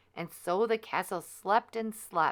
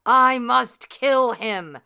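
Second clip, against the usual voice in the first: loud, slow, higher furious threat; furious vow; 6 semitones higher, 14 dB louder, slower than usual. Acted. Wicked fairy raging at her bird about the prince. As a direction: spit out the vow to kill.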